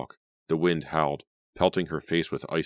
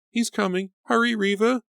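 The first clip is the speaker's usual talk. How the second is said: high, loud terrified urging; terrified exclaim; 5 dB louder than usual. A narrator's reading. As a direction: exclaim it in terror. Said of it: "Grace exclaimed".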